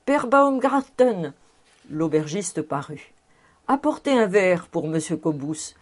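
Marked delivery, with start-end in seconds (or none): 0.1-1.3 calling out again. A narrator's reading. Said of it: "criait le professeur Speck".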